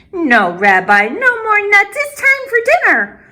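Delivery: put-on high, bright old grandmother voice; old woman greeting a visitor at her door; Baba Brina greeting. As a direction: welcome the guest like a kind old granny.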